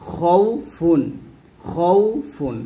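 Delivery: খৌফুন with throaty, rounded khou; heavy kho. Shown as a demonstration. Teacher modelling the next word, heavy kho. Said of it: "ঠিক একইভাবে পরেরটা হবে".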